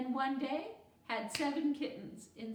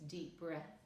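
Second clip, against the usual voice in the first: calming breath; 6 semitones lower, 10 dB quieter, slower than usual. A narrator's reading. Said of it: "did her breathing exercises".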